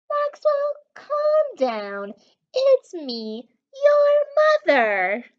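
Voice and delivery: high, lightly voiced mother calling out. Mama Moose calling to her scared son, lightly voiced. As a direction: call back soothingly like a mom. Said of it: "A voice called back".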